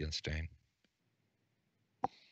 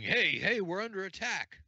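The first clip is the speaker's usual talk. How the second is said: yelled alarm; 11 semitones higher than usual. Acted. Game master voices an orc warning comrades, yelling. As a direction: call out the alarm as a fleeing orc. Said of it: "but he's yelling".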